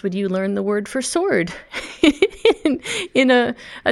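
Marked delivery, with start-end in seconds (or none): 1.6-2.6 high laugh. Real, not acted. Laughs about the word sword.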